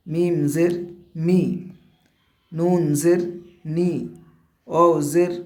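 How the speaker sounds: letter name, জের, then a short i syllable; teacher reciting letter plus জের then the i sound, one by one; spelling drill with zer. Shown as a demonstration. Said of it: "এইভাবেই আমাদের প্রত্যেকটা অক্ষর সুন্দরভাবে উচ্চারণ করতে হবে".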